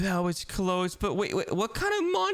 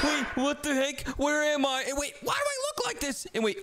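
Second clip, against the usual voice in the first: ordinary talk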